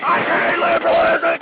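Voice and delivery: high, loud, angry declaration; shouted hatred. Real, not acted. Host shouts his hatred of liberalism, angry. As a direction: spit it out loud.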